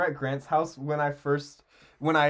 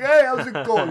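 higher, louder jokey wiseguy greeting; goofy Italian guy; 9 semitones higher, 9 dB louder than usual. Pretend. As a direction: ham up a street tough. Jokey voice for Tom.

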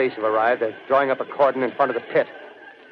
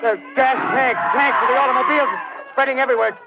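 panicked screaming; 11 semitones higher than usual. Acted. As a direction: shriek it out, losing control as the flames come closer. Reporter watches men burst into flame.

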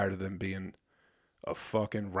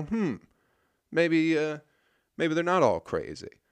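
mock pondering; 6 semitones higher than usual. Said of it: "make you go".

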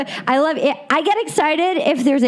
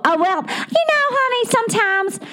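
Southern accent; 10 semitones higher than usual. Shown as a demonstration. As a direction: sweet-talk in a Southern accent. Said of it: "I can do Southern".

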